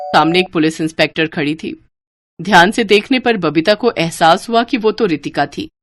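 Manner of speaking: ordinary delivery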